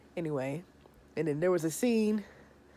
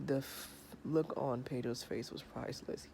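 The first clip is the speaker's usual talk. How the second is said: ordinary talk, nothing marked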